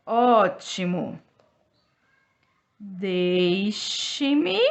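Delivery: word by word, long pauses between; syllables drawn out for children to copy; slow dictation. Shown as a demonstration. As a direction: dictate slowly while writing on the board. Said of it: "teacher dictating while writing on board".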